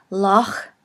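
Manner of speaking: "Loch" ending in a back-of-throat ch; guttural ch. Shown as a demonstration. Teacher giving an example of the guttural, back-of-throat ch. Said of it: "pronounced in the back of the throat".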